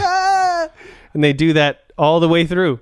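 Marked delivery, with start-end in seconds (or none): 0.0-0.7 mock scream. Someone dragged out.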